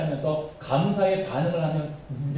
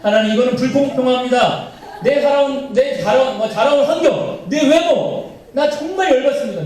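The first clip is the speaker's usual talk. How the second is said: loud, high-pitched protest to God; mock angry outburst; 8 semitones higher, 7 dB louder than usual. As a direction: rant about unfairness, voice pushed up high. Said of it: "막 호로 화를 내고 이러는 거예요". (과장되게 화내며 높고 크게 따지는 말투)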